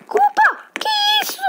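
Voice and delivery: very high startled cries; frightened shriek. Real, not acted. Susto com monstro. (grito de medo)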